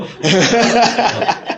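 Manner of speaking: loud high laugh. Real, not acted. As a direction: laughs out hard. Laughs at the answer.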